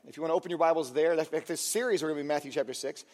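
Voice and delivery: ordinary talk, nothing marked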